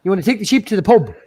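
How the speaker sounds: hammed-up rural Irish accent. Shown as a demonstration. Streamer demonstrating farm and pub talk, in an Irish accent. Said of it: "That's an Irish accent".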